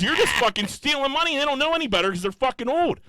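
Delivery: high-pitched, worked-up accusation; retold screaming rant. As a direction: rant at them, voice pitched right up. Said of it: "Screaming at the top of my lungs".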